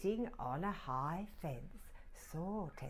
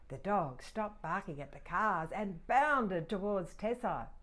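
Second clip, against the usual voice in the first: ordinary delivery, nothing marked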